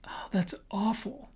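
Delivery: hushed whisper of dismay; breathless whispered shock. Shown as a demonstration. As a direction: whisper it in horror. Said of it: "You can also say".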